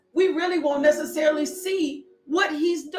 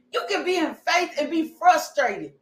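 usual speaking voice

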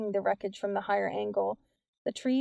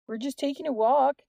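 raised, higher calling voice; yelling down; 5 semitones higher, 4 dB louder than usual. A narrator's reading. Boy answering the officer below, moderate yell. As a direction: call it down the hill, a restrained yell. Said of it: "Jordan yelled down".

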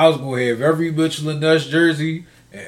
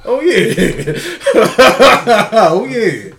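laughing gloat; 4 semitones higher, 6 dB louder, much slower than usual. Real, not acted.

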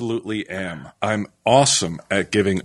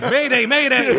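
mock distress call; 14 semitones higher, 4 dB louder than usual.

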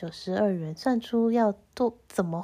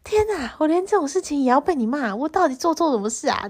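self-pitying wail; 6 semitones higher, 4 dB louder, faster than usual. Retold or imitated. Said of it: "但一边又觉得".

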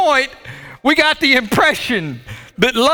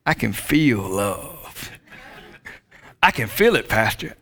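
low, slow, soft mock-dreamy voice; lightly voiced lovestruck member; a hint of a swooning believer; mimicking a churchgoer; 10 semitones lower, 6 dB quieter, slower than usual. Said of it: "their response is one simple thing".

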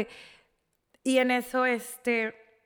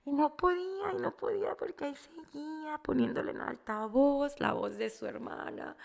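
talking through tears; 4 semitones higher than usual. Real, not acted. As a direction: keep telling it while really crying. Crying as she tells it. (hablando entre lágrimas)

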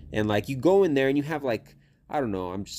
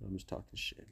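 much softer, breathy under-the-breath repeat; whispered aside; 4 semitones lower, 16 dB quieter than usual. Real, not acted. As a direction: drop it to an almost whispered aside. Repeats line, second time quieter.

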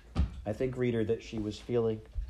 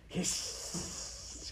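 long sibilant jeer; hissed; 9 semitones higher, 8 dB quieter than usual. Acted. Other rats jeer at the crying rat, hissed. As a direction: stretch the s into a voiceless hiss. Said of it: "hissed the other rats".

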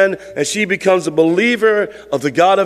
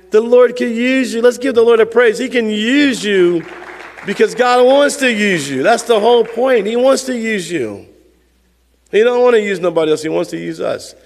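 preaching; 4 semitones higher than usual. Acted. Pastor rallying the church to praise, exhorting. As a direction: lift the room toward praise.

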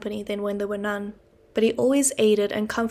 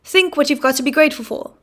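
higher, louder lecturing; pompous uncle; 5 semitones higher, 7 dB louder than usual. Acted. Pumblechook lecturing the boy on gratitude, loud and pompous. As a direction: wag a finger as you lecture.

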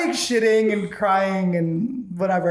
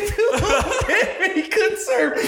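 high, slow, laughing mock grandeur; laughing mock formal opening; mock formal letter voice; 12 semitones higher, slower than usual. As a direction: read it like a pompous Shakespeare. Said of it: "I tried to sound like sh-, fucking Shakespeare".

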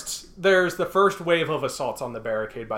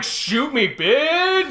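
much higher mock taunt; mock defiance; 10 semitones higher, 3 dB louder, much slower than usual. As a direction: taunt the captors. Paraphrase of defiant prisoner.

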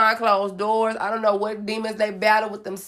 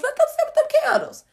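mocking high squeak. Real, not acted. Mocking high-pitched jab.

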